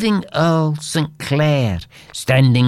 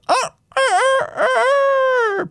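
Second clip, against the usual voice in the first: high crowing cock imitation; rooster crow; faster than usual. A narrator's reading. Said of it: "the sound of the rooster".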